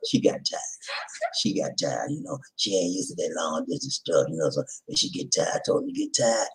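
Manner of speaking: soft unvoiced whisper; whispered praise to his wife. Real, not acted. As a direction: hush it gently. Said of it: "tender talk to driver".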